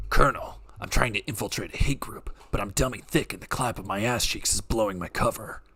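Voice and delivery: low, deadpan soldier voice; Snake voice. Acted. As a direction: report in like a stealth soldier. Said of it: "that's a great Snake voice".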